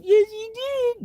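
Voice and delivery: high, slow shouted yes; hammed-up shouting match; mock shout. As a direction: insist loudly, dragging it out. Acted egg quarrel escalating.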